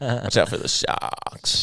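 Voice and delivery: slow unvoiced mock Boston warning; mock Boston accent. Imitating a Boston mom's warning, slow Boston accent. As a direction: drop the r in sharks, slow and breathy. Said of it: "Boston accent".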